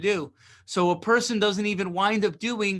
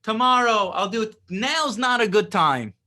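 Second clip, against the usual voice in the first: higher, louder mock excuse, brushing it off; mock procrastinator; 5 semitones higher, 5 dB louder than usual. Teacher mimics a student dodging the work, mock excuse. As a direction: play the lazy excuse-maker, lightly hammed. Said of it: "right, they say".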